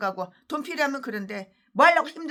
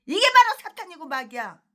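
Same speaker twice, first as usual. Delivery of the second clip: normal talking voice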